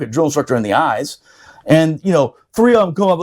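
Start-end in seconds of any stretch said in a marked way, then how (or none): none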